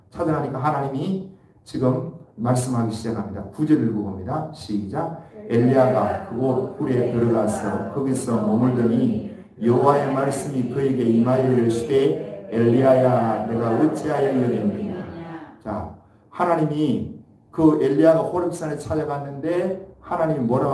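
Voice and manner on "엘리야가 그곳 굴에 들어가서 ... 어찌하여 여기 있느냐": steady, measured Bible reading to the church; scripture reading. Acted. Pastor reading scripture to the congregation, measured. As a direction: read the verse aloud, even and formal. Reads verse after "시작.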